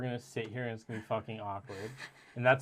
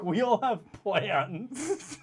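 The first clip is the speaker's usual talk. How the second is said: mocking quote; 10 semitones higher, 5 dB louder, much slower than usual. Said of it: "and you were like".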